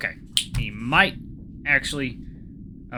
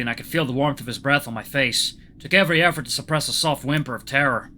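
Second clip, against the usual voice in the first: ordinary delivery, nothing marked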